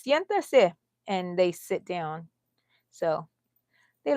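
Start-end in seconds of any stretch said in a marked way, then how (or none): 0.0-0.7 Siéntese said crisply in Spanish, a touch higher; firm Spanish command Siéntese. Retold or imitated. Telling the dogs to sit in Spanish, crisp Spanish command. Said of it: "she says".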